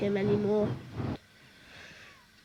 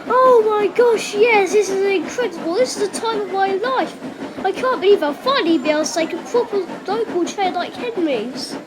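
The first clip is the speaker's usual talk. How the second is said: loud, higher, overjoyed gushing; thrilled; 8 semitones higher, 7 dB louder than usual. Acted. Thomas gushing about finally pulling a proper train. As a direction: burst with delight.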